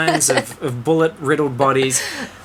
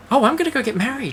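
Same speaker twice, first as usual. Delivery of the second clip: high, fast and breezy; airy princess voice; 10 semitones higher, faster than usual. Retold or imitated. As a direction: play a giddy bride-to-be. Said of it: "She's just more like".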